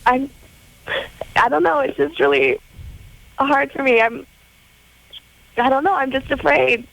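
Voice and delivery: crying. Real, not acted. Caller explains her fear for her husband, crying. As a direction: struggle to get it out through tears. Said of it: "I am crying".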